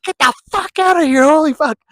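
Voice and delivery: put-on high, loud, gruff old man shouting; high-pitched loud old man outburst; old guy yelling. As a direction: bark the would-be robbers away as the old guy.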